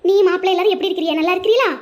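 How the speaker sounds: ordinary delivery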